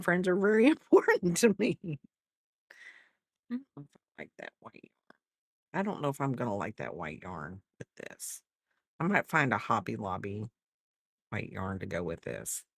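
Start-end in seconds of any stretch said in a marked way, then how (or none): 3.5-12.6 much softer, fast, mumbled to herself; quiet aside. Real, not acted. Muttering to herself about yarn.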